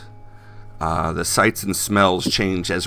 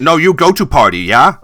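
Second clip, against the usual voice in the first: put-on insistent Chinese-accented English, high and very loud; pushy cabbie with a Chinese accent; Han; 9 semitones higher, 13 dB louder than usual. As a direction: press the point loudly in a Chinese accent. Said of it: "accented with Chinese".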